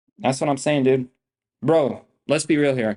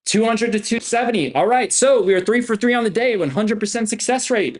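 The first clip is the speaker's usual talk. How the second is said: higher-pitched, triumphant recap; pumped-up announcing; 7 semitones higher than usual. Real, not acted. Trader telling viewers his perfect score, pumped up. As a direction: announce the wins with a real rush.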